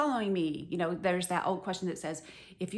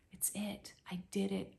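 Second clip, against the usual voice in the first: soft, near-whispered satisfaction; quietly fulfilled; a calm whisper of completion; hushed contentment; 9 dB quieter than usual. Acted. John content, mission done.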